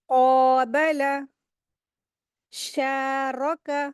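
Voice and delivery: very slow drawn-out reading word by word; slow recitation. Shown as a demonstration. Teacher reading examples to the class: slow recitation. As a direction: read each word slowly, vowels stretched. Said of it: "रॉ को heavy करेंगे".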